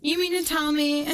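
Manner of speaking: ordinary talk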